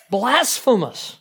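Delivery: slow, high, loud outraged pronouncement; scandalized verdict. Retold or imitated. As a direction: pronounce it like an offended rabbi. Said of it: "what the rabbis would have said".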